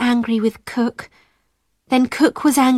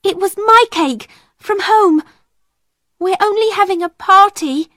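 high-pitched, protesting plea; pleading cry; 9 semitones higher, 4 dB louder than usual. A narrator's reading. Ermengarde defending the party to Miss Minchin, pleading. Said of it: "cried Ermengarde".

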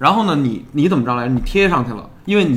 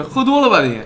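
imitated heckler; 7 semitones higher than usual. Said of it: "旁边竟然有一人就搭了一".

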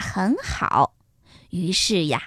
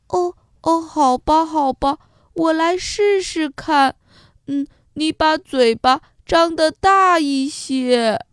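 higher-pitched, good-natured giraffe voice; obliging giraffe; 4 semitones higher than usual. A narrator's reading. Honest giraffe agrees to help the wolf, obliging. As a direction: agree hesitantly in a raised voice.

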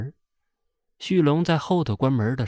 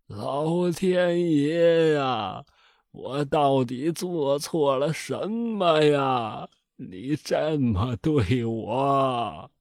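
very slow, drawn-out crying to heaven; wailing lament; 3 semitones higher, much slower than usual. A narrator's reading. Old father over his dead son, slow tearful wail. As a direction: grieve aloud, stretching each word. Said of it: "边哭边说".

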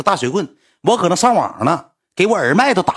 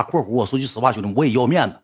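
usual speaking voice

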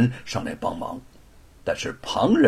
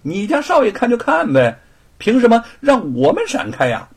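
indignant protest; 11 semitones higher, 6 dB louder than usual. Acted. Onlookers protest the servant.

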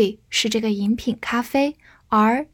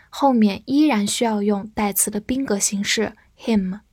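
ordinary talk, nothing marked

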